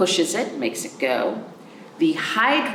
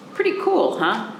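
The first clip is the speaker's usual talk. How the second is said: excited; 7 semitones higher than usual. Real, not acted. Teacher excited to kids.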